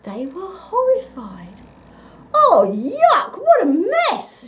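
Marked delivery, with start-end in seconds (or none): 2.3-4.5 high, loud disgusted shout; shouting children. A narrator's reading. Rabbit children see rubbish on their lunch, shouting. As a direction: yell in disgust like kids. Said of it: "shouted the children".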